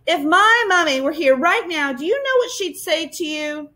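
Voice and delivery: put-on indignant child. A narrator's reading. Girl pushed too far, scolding.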